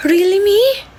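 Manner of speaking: surprised. Real, not acted. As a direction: feign shock at the compliment. Reacts to being told he changed.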